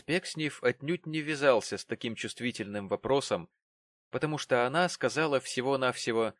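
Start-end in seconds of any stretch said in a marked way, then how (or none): none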